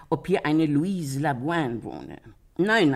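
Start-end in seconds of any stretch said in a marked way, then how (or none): 2.6-2.9 bestimmtes Nein. Retold or imitated. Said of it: "hab ich gesagt".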